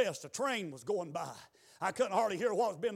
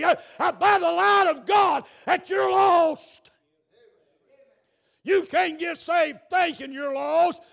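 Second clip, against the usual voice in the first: high, very loud revival shout; full-force preaching; 9 semitones higher, 13 dB louder, slower than usual. Real, not acted. Preacher urging the lost to be saved tonight, full-force preaching. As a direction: shout the appeal to the whole church.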